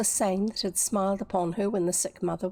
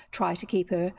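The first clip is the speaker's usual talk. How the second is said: usual speaking voice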